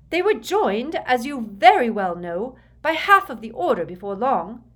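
high, loud, angry retort; heated, forceful correction; snarling. A narrator's reading. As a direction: snarl back in defiance. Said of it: "snarled Bellatrix".

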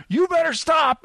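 high-pitched warning call; mock holler at a fleeing man; calling after someone. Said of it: "you can call after me".

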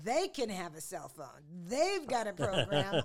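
loud, comically indignant rant; retold outrage. Envying a stranger's cell phone, mock outrage. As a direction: build to a loud, drawn-out mock protest. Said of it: "I was so pissed".

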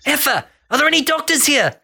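high, panicky cartoon voice; freaked-out Rocco. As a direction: squeal for a doctor in a high panic. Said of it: "Rocco freaks out and he's like".

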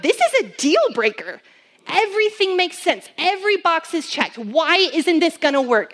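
a mild mock shout against God; flesh protesting. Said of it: "my flesh wanted to shout out".

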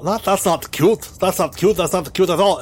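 high scolding character; scolding droid voice. Acted. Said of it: "So he's like".

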